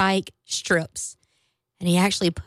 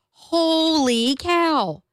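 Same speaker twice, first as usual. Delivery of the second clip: holy cow; 7 semitones higher, much slower than usual. Real, not acted. Shocked reaction to screws.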